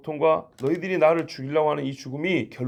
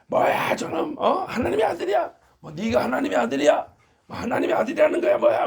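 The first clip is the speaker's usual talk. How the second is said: much higher, jeering outraged voices; mocking shouts; 10 semitones higher than usual. Angry council jeering at Jesus, high mocking shouts. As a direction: jeer at him like a furious crowd. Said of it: "고함을 지르는 거죠".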